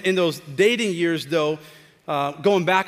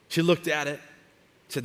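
usual speaking voice

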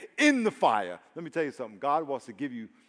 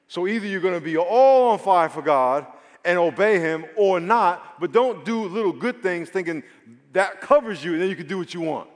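preacher's rising, ringing delivery, louder; preaching at full force; 5 dB louder than usual. Real, not acted. Pastor urging the congregation, full-force preaching. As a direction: lean in and preach it hard.

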